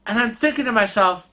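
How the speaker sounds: ordinary delivery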